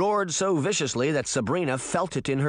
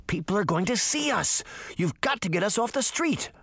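higher-pitched urgent insistence; insistent; 6 semitones higher than usual. A narrator's reading. Sabrina urging Puck to leave the street, insisting. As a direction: press the demand urgently. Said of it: "Sabrina insisted".

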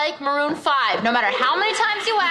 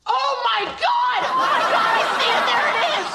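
panicked cry; 6 semitones higher than usual. Acted. Roommate spotting a rodent, panicked cry. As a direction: shriek in fright at the sight.

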